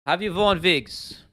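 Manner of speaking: put-on high, slow accented question with V for W; put-on accent, drawn-out "wigs"; put-on interview accent. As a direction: quote it slowly in a mock foreign accent. Quoting the wigs interview video.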